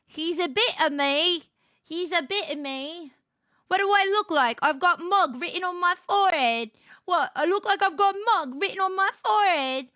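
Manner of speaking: light fake British reality-show accent; Love Island British accent. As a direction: play a Love Island contestant. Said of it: "Love Island prepared me for this moment".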